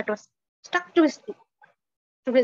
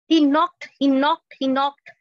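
louder, rising with each knock; building emphatic repetition; 6 dB louder, slower than usual. A narrator's reading. Storyteller acts out the eagle's knocking, loud and insistent. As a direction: pound out every knocked like a blow.

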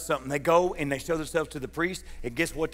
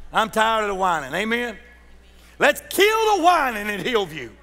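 full-force preaching; 8 semitones higher, 10 dB louder than usual. Acted. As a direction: preach it out to the rafters, drawn long.

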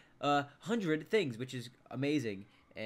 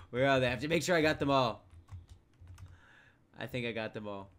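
normal talking voice

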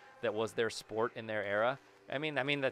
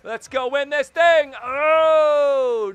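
much higher, much louder rallying yell; hyped shout; 12 semitones higher, 14 dB louder, much slower than usual. Real, not acted. Gamer rallying his team for the final seconds, hyped shout. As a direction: belt it out like a war cry.

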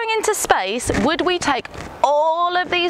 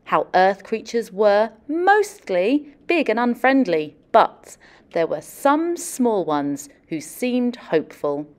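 picture book story narration; storybook reading; 6 semitones lower than usual. Acted. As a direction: tell it gently to a class of kids. Reads picture book aloud.

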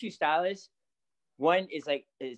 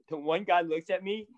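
ordinary delivery

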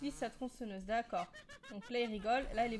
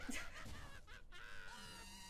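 screaming laugh; 14 semitones higher, 14 dB quieter than usual. Real, not acted. After failing, big scream-laugh.

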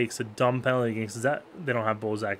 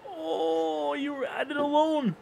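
disbelief; 13 semitones higher, slower than usual. Real, not acted. Streamer groaning at a teammate's wasted chance, high disbelief.

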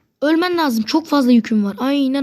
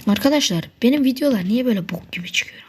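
whispered complaint. Real, not acted. Complains to viewers in aside.

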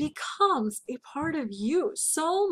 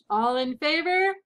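formal call to the room, drawn out and louder; calling the vote; 4 semitones higher, 6 dB louder than usual. A narrator's reading. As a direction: put the question to the whole meeting, voice lifting at the end. Board member calls a vote.

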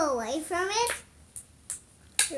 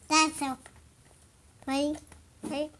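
normal talking voice

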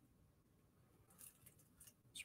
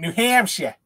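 put-on Boston-style accent, "Hampshire" ending with no r; New Hampshire accent; 4 semitones higher than usual. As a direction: say "Hampshire" as "Hamp-shyeh" in a raised New England accent. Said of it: "You gotta say it with the New Hampshire accent".

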